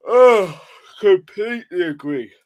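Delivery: yawning through the words. Real, not acted. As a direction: talk mid-yawn. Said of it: "I know I'm yawning like mad".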